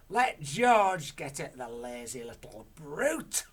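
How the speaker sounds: very loud, slow bellow; Grandma shouts about George, slow and loud; shouted. Acted. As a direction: sneer at the boy. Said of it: "Grandma shouted".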